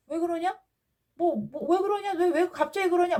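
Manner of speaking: high-pitched mock "who, me?" voice; feigned innocence. Father confronted about backbiting acts puzzled, high feigned innocence. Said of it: "마치 아무 일도 없었는데".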